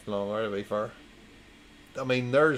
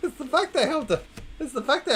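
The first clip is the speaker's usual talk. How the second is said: high-pitched; 14 semitones higher, faster than usual. Real, not acted. Laughing exasperated aside.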